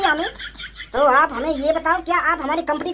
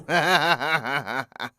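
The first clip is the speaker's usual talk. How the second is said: low villain laugh; 4 dB quieter than usual. Acted. Thug laughs after confession.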